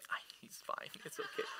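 very soft, near-whispered aside; hushed reassurance. Retold or imitated. Dad excusing crying son to passers-by, hushed. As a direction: drop to an embarrassed undertone.

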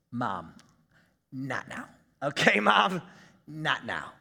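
deep, hushed mock brush-off to his mother. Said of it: "I love Mary's response to Jesus going".

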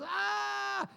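high hammed-up cry of pushing; mock labor scream. Preacher imitating wife's push scream. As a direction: yelp it like a woman giving birth. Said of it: "She goes".

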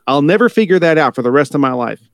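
ordinary delivery

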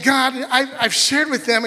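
high, loud protest; protesting believer. Retold or imitated. Believer protesting to God, high and loud. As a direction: defend yourself to God.

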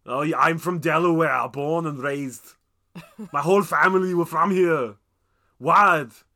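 put-on loud, heavy Austrian accent, drawn out; Arnold Schwarzenegger. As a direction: belt it out as an Austrian action star. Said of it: "it's like Schwarzenegger".

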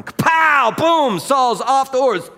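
very loud, high-pitched lightning blast; explosive shout. Acted. Preacher acting out Saul thrown from his horse, explosive shout. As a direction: shout it like a sudden strike.

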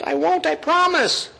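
high-pitched, hammed-up promise; insistent mock pledge; Peter. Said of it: "Peter says".

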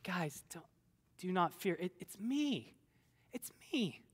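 hushed, acted soothing voice; softly calm frightened friends, as if retelling it; gentle reassurance. Jesus calming the frightened disciples.

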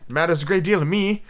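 plain reading voice

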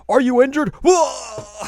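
high, loud alarmed shout; mock alarmed guy. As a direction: yelp it like a startled stranger. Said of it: "a guy who comes like".

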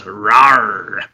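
low mock monster roar; growl. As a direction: growl it like a beast. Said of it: "Jay says".

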